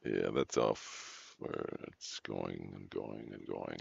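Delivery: low, soft, slow call of the rolling ball; golf commentator. Real, not acted. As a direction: follow the putt in a hushed voice. Hushed call following the rolling ball.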